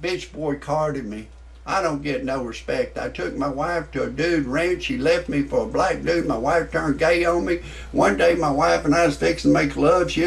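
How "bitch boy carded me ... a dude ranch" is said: ordinary delivery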